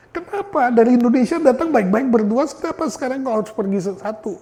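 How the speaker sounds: high, pleading cry of why; a widowed mother asking why, lightly voiced grief; grieving mother's question. Mother asking why her husband had to go, plaintive. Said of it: "mamanya mengatakan".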